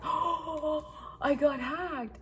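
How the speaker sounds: high, hammed-up voice of a husband playing dumb; mock innocent husband. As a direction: squeak it out like a caught liar faking surprise.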